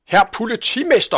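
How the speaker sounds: high, loud indignant call; shouting. A narrator's reading. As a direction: call out sharply to the police chief. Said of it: "råbte slagteren". (højt, ophidset råb)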